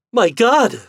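high, loud terrified shriek. A narrator's reading. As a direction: scream in sudden fear. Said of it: "shrieked Fidèle".

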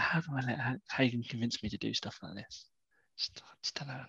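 muttered very softly to himself; hushed, private complaint, barely audible; under his breath. Acted. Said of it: "talking under his breath".

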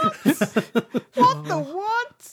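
very high, drawn-out mock astonishment; stunned disbelief. Reacting to being recognised by writers, in stunned disbelief.

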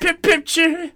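put-on high, loud botched Kiwi accent; botched New Zealand accent. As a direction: fumble into a silly fake Kiwi accent. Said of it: "how to do a good New Zealand accent".

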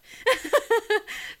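high bursting laugh; embarrassed laugh. Real, not acted. Admitting her smart mouth, real high laugh. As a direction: laugh out at yourself.

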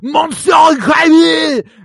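high, loud, over-the-top French shout; hammed-up French villain's cry; overwhelming French accent. Greeting the superhero as the villain, shouted thick French accent. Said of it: "The way he greets Mister Incre".

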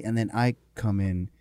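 usual speaking voice

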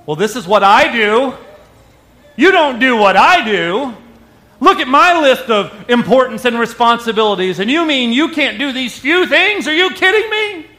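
loud, rising, indignant complaint; mock outrage at your partner; indignant spouse. Acted spouse complaining, comparing lists.

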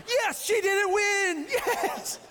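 high, drawn-out mock cheer; super excited. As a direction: whoop in hammed-up triumph. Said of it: "I'm super excited".

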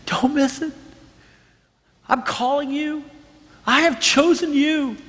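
high, gentle pleading call; earnest, high-pitched divine appeal; God. Acted. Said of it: "maybe today God's just saying".

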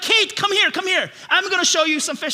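a touch of an eager dad's call; excited father. Retold or imitated. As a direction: eagerly call a small child over to look. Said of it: "as a good father, I'm like".

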